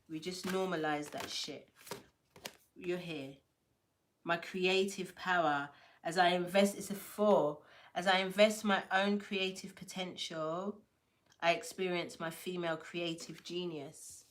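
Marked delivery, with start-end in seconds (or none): none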